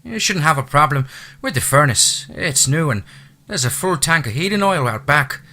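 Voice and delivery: high, loud Irish housekeeper voice; chatty Irish brogue, pitched high. Acted. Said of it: "her lyrical accent".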